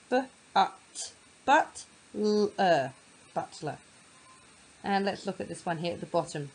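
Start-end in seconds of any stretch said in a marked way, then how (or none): 0.1-3.8 b, ut, but said as split sounds, then blended into butler; sounding out. Shown as a demonstration. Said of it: "sounded out".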